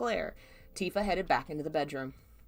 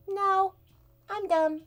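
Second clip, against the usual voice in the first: voicing the cat; 12 semitones higher, slower than usual.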